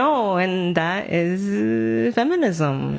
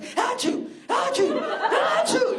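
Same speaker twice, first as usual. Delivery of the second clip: high mock sneezes; 14 semitones higher than usual. Imitating man sneezing.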